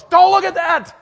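high, loud shriek; wife's yelp. Retold or imitated. Wife in the taxi shrieking at her husband, high and loud. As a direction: yelp it in alarm. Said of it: "my wife goes".

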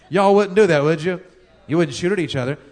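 calling out to the crowd; loud. Real, not acted. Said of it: "quiet crowd".

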